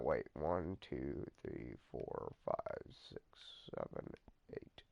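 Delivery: soft, low counting almost whispered; counting under breath. Shown as a demonstration. Vlogger counting down a pause, murmured low. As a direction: count quietly to yourself. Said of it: "this one's longer".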